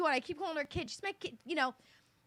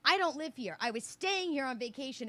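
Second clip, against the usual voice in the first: normal talking voice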